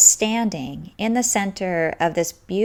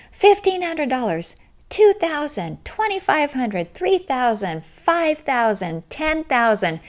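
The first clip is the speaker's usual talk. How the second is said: imitating bids called out; 7 semitones higher than usual. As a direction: call out bids one over another. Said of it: "the room just comes alive and you hear".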